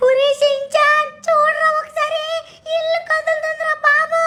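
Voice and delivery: high cartoon buddy voice, anxious; cartoon robot cat voice. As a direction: pitch way up for the sidekick, uneasy. Sidekick sees house moving.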